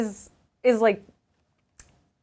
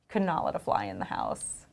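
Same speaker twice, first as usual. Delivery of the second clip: normal talking voice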